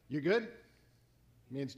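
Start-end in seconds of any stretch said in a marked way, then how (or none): 0.1-0.5 casual check-in. Retold or imitated. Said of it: "they go".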